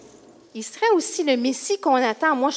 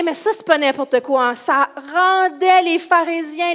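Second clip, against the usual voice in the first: ordinary talk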